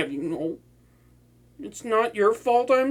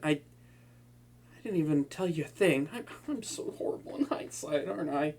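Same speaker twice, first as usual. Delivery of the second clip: softer, hushed, stumbling; remorseful apology; 4 semitones lower, 8 dB quieter than usual. Acted. Villain apologizing to the hero, soft remorseful confession. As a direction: confess quietly with shame.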